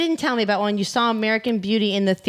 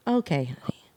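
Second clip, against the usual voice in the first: deadpan; 6 dB quieter than usual. Retold or imitated. Player quoting a movie husband answering honey, deadpan. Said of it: "he's very deadpan too".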